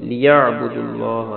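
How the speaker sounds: اللہ with a full, heavy لام; heavy lam demo. Shown as a demonstration. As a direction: give the لام in اللہ a thick sound. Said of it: "لام کو موٹا پڑھنا ہے". (اللہ کی لام موٹی)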